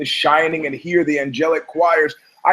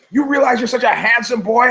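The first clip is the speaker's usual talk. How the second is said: mother's voice; 7 semitones higher than usual. Retold or imitated. Voicing his mother.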